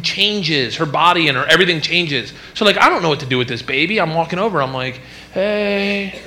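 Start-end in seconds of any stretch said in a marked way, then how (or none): 5.3-6.2 tentative, higher-pitched coaxing; mock placating dad. Clueless new dad soothing his newborn, mock placating. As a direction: lightly play an awkward dad negotiating.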